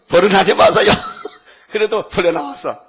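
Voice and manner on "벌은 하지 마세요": louder, laughing mock plea; hammed-up pleading with a laugh. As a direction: beg the policeman half-jokingly. Mother pleading in retold story.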